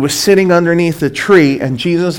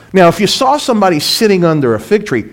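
usual speaking voice

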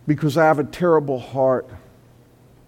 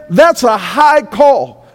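loud slow declaration; 9 semitones higher, 8 dB louder, slower than usual. Acted.

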